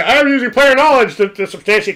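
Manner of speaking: high, loud mock yell; hammed-up panicked confession; mock screaming. Metagaming player cornered in play, mock high scream. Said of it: "all he can do is start screaming".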